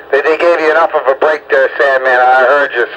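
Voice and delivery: ordinary delivery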